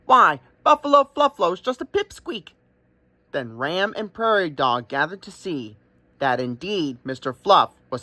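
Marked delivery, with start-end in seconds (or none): 0.1-2.5 much higher, louder teasing crow voice; grinning crow. Acted. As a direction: tease him like a cheeky crow. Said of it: "with a grin on his beak".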